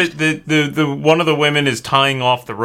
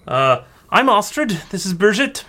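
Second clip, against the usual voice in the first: high-pitched village woman, introducing herself; dockside woman; 11 semitones higher than usual. Acted. Astrid introducing herself to arriving travellers, high woman's voice. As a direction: greet strangers in a much higher character voice. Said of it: "the woman approaches you and she says".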